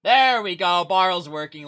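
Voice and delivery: relieved cheer. Real, not acted. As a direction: celebrate the fix suddenly and loudly. Overlay finally works.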